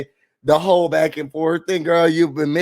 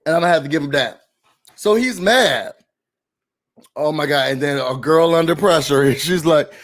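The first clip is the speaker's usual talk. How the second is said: usual speaking voice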